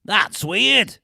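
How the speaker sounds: put-on Cheshire accent. Voicing the co-star's reaction, exaggerated Cheshire accent. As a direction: exclaim it in a mock Cheshire accent. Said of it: "said Nicole".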